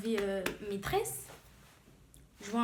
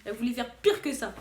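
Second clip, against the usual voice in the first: normal talking voice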